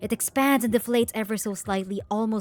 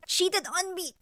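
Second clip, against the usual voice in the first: hurt accusation; 9 semitones higher than usual. Acted. Acted accusation in a quarrel.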